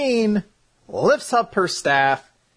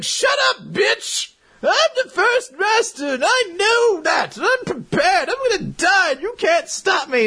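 high, strained mock-heroic shout; hammed-up screaming match, voice pushed way up; defiant yelling; 3 dB louder than usual. Makarov shouting down Mavis before dying, hammed-up defiant yell.

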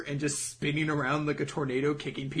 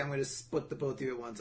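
quiet mock threat; 4 semitones lower, 10 dB quieter, faster than usual. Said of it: "I imagine he's saying".

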